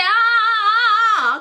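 high, slow sing-song warning. Retold or imitated. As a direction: stop her sister with a sing-song ah ah. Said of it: "I said".

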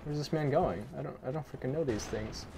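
ordinary talk

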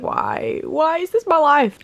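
hammed-up wail, rising high; mock despair. Vlogger moans about her collapsing tripod, mock despair. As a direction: whine it in comic exasperation.

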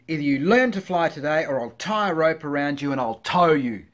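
loud scolding threat; loud, hammed-up angry mum; screaming mother pigeon. A narrator's reading. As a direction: yell an ultimatum at your chick. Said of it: "screamed the mother pigeon".

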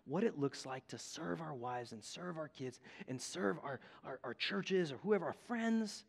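quietly proposing a pact to a buddy; quiet retold invitation. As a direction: lean in and ask it gently, like a friend. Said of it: "And he's like".

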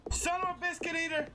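high-pitched mock read of the on-screen line. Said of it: "Wait, it says".

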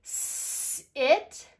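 long hissed "S-", then loud high "it"; sounding out. Shown as a demonstration. Teacher reading rhymes, long "S-" then "it". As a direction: stretch "S-", then punch "it". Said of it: "let's read our rhymes".

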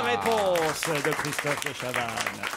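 annonce de jeu. Acted. Animateur proclame la bonne réponse.

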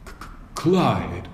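stumbling over the name in shock; a dazed knight stammering. A narrator's reading. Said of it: "stammered the flabbergasted knight".

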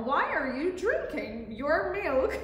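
giggling question; laughing. Acted. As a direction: laugh through the words. Laughing at drinking cow.

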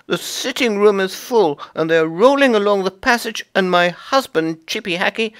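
very high, indignant chipmunk wife; high upset chipmunk wife; Mrs Chippy voice. Acted. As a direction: complain about the nuts, high and fussy.

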